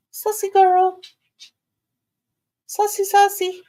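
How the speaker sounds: high loud coaxing pet talk; high cooing call to the puppy; coaxing the dog. Real, not acted. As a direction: coax her up in a high sweet voice. Coaxing Sassy.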